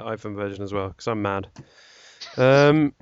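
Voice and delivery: ordinary talk, nothing marked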